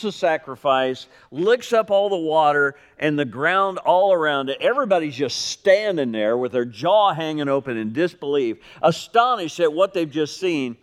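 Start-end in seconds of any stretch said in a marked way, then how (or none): none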